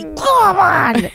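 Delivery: high teasing "come on"; playful protest. Real, not acted. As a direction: whine it playfully.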